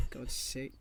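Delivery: exasperated whisper under the breath. Real, not acted. Whispering in exasperation at the missing catchphrase. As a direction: mutter it to yourself, voiceless.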